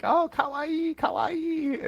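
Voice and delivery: very high mock-cute voice; high, drawn-out teasing coo; joking boys. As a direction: imitate kids squealing a meme word. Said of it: "joking around about that".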